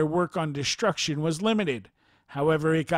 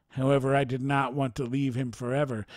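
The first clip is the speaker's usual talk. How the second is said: ordinary delivery, nothing marked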